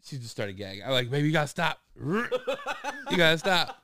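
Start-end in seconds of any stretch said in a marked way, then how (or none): 1.1-3.8 louder, higher, laughing through it; laughing plea. Retold or imitated. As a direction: beg her to stop while cracking up. Dad laughing at gagging girl.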